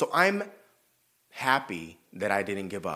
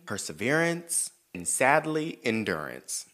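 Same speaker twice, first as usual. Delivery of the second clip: slow proud declaration; 2 semitones lower, slower than usual. Real, not acted.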